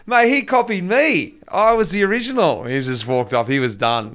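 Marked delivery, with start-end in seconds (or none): 0.1-2.6 mock outrage. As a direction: ham up being wounded. Mock outrage about the mustache.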